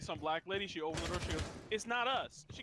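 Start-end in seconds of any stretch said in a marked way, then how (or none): 1.7-2.5 raised-pitch, emphatic quote; imitated firm retort. Lady opens car door to answer Karen, sharp retort. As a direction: call it back higher and quicker to protest.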